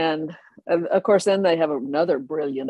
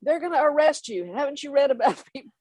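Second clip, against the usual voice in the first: angry colonel; 5 semitones higher than usual. Retold or imitated. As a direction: bark at the kids on the roof like an outraged colonel. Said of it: "Colonel Poole is like".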